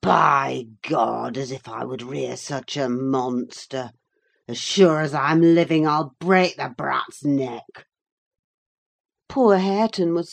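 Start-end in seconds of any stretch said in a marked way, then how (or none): 0.0-7.9 put-on gruff, louder threatening voice of a drunk father; drunken threat. A narrator's reading. Drunken father threatening the nurse, in a rough angry voice. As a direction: snarl it like a drunk brute. Hindley threatening to kill baby.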